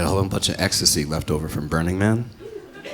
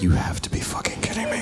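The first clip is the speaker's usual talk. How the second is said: disbelief; 3 dB quieter than usual. Retold or imitated. Comic's disbelieving inner reaction.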